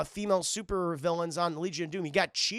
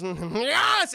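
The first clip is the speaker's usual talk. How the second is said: triumphant yes; 5 semitones higher, 6 dB louder than usual. Retold or imitated. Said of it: "And he goes".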